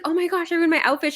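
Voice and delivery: high, fast flustered friend voice; imitating a panicked friend. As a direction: play the frazzled contestant, rushing. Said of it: "And then she's like".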